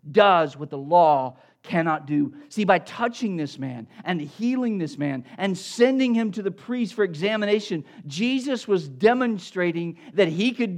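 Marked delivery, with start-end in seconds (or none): none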